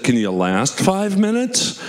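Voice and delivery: lightly voiced teen girl; mock teenage girl. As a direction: play the bored teen ride worker asking sweetly. Teenage attendant's reply voiced.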